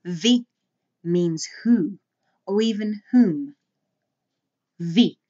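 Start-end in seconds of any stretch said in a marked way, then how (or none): 4.8-5.2 wie said as vee, short and firm; teacher modelling wie; Afrikaans wie. Shown as a demonstration. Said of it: "Notice the short E sound".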